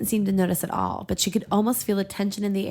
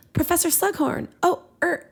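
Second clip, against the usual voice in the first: flustered schoolgirl; 11 semitones higher than usual. Acted. Hermione caught by the professor, flustered and higher. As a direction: stammer out an excuse, caught red-handed.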